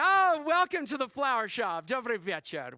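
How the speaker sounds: hammed-up Russian florist, opening high and loud; flamboyant Russian florist. Russian flower shop owner greeting a customer, flamboyantly theatrical. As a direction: burst into a flamboyant Russian welcome.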